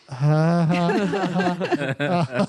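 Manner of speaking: imitated ha-ha-ha of onlookers; put-on laughter; mocking crowd laugh. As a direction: act out a group laughing along. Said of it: "all the reds are like".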